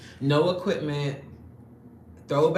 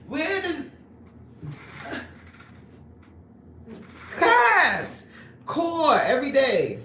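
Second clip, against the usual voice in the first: fired up; 8 semitones higher, 4 dB louder than usual. Real, not acted. Worked up about her class.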